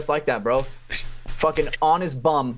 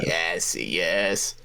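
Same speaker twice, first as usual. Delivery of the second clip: low, soft gloating yes; understated low gloat; satisfied; 8 semitones lower, 3 dB quieter than usual. Retold or imitated. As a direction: savour it quietly. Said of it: "I'm like".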